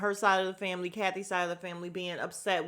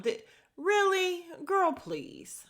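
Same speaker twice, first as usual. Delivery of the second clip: incredulous; 9 semitones higher, slower than usual. Real, not acted. Incredulous reaction to Kyle.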